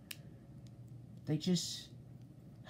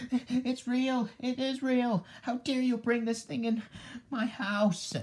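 high-pitched, indignant outburst; outraged panic; 9 semitones higher than usual. Acted. How dare you bring it in my house, outraged panic. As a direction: scold in frightened outrage.

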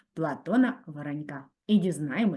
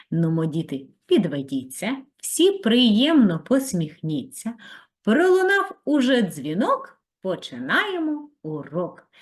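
sing-song rhyme; 5 dB louder than usual. Acted. Teacher rhyme to children.

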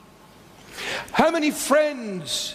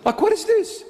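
incredulous; 11 semitones higher than usual. Real, not acted. Incredulous mocking question.

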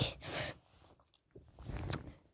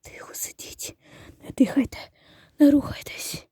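unvoiced whisper to keep still; whispered hush; 4 semitones lower, 6 dB quieter than usual. Telling the girls to stay still so the bumblebee won't hear, whispering. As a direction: hiss a warning to stay silent. Hushing others while hiding.

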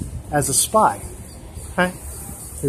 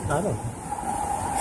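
muttered aside; 10 dB quieter, much slower than usual. Real, not acted.

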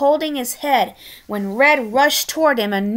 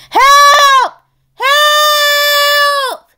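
high, loud desperate shout for help; panicked screaming for help; panicked cry for help; 9 dB louder than usual. Acted. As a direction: scream for help, trapped and blind. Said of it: "Tiny Pigs panicked".

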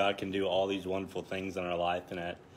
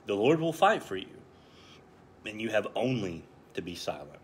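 scripture reading; 3 semitones higher, 3 dB louder than usual. Acted. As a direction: proclaim the verses like a preacher. Reads scripture verses aloud.

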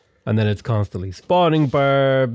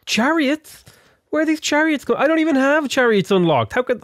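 much higher, baffled yelp; startled disbelief; 12 semitones higher than usual. Real, not acted. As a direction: squeak out the confusion. Sees enemy chariots unexpectedly.

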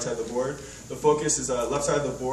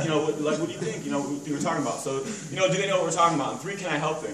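usual speaking voice

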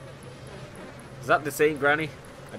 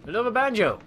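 exasperated shout; 12 semitones higher, 10 dB louder, faster than usual. Real, not acted. Annoyed at finding another banjo.

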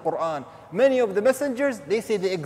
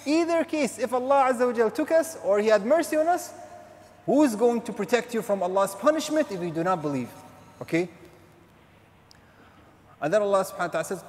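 usual speaking voice

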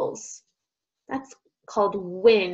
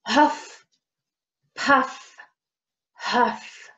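panting again; much slower than usual. Shown as a demonstration. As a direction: huff puff huff, breathy. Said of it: "let's try that again".

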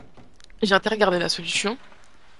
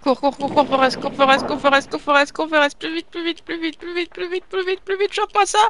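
higher and higher chant, rising steadily to the end; urging cheer; 7 semitones higher than usual. Real, not acted. Gamer cheering his character on like Forrest Gump, building pitch. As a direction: egg the runner on like a crowd.

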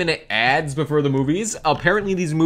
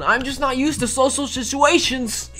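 mock whimper; 9 semitones higher than usual. Awkward apology to the theater.

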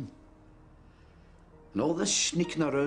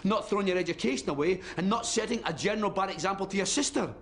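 raised, high-pitched parental telling-off; angry scolding; 7 semitones higher than usual. Acted. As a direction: lecture your son, pitch climbing as exasperation builds. Father scolds his son.

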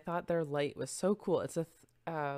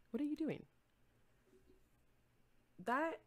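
scolding the cat; 11 semitones higher, 6 dB quieter than usual. Real, not acted. Scolding the cat chewing cord.

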